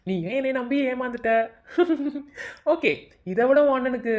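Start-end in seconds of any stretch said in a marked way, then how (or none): 1.7-2.7 high mocking cackle; gloating laugh. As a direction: laugh at how easily she fell. Villain gloats over her.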